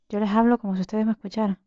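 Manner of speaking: usual speaking voice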